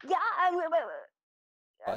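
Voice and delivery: calling out in alarm; yelling. Pretend. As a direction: shout it out to the guards. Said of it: "I'm gonna yell".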